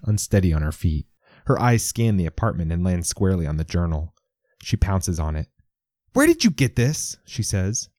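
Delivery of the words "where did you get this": put-on high, sharp accusation in a 1940s accent; accusing demand. Leisha grabbing the journal from Lewis: accusing 1940s accent. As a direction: point the question like a gun. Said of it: "a statement pointed like a gun".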